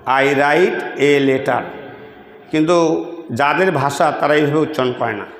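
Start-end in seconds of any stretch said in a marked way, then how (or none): 0.1-1.6 "I write a letter" very slow, each word apart with a hard t; careful local classroom reading of "I write a letter"; Bangladeshi English. Shown as a demonstration. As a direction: spell out "I write a letter" slowly, word by word, as taught at home. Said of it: "এটা আমাদের দেশে উচ্চারণ করি আমরা এইভাবে".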